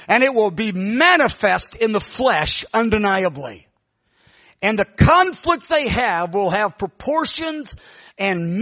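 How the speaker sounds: drawn-out prophetic cadence with heavy emphasis; prophesying woman. Retold or imitated. Woman delivering the word of the Lord, slow forceful prophecy. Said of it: "says the Lord".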